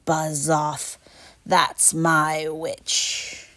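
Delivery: beast's growl. A narrator's reading. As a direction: growl it slowly at the dragon. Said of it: "a yowl and a growl".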